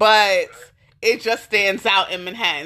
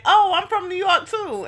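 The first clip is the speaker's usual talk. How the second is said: mock upstate claim; 9 semitones higher than usual. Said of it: "who be like".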